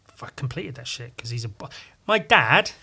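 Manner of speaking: ordinary talk, nothing marked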